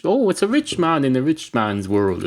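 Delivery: usual speaking voice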